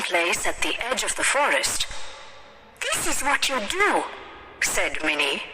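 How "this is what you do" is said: put-on high girlish teaching voice; high, eager child character; bossy little instructor. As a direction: instruct a doll as a little girl. Voices the girl Minnie.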